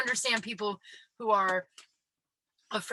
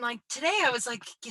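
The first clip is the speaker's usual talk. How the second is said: usual speaking voice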